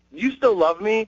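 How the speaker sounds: high-pitched lovey-dovey pleading question; mock sad, needy. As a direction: imitate a girlfriend who misses you, voice lifted. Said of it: "when she's really sad and she misses me".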